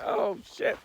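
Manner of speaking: laughing groan after the pain; stubbed toe. Real, not acted. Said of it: "Stubbed my toe on a rock".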